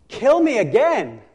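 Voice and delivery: loud, slow, high angry plea; losing his temper. Acted. Jonah losing his temper with God, slow loud angry demand. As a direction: snap it out like a sulking prophet. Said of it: "he loses his temper again and says".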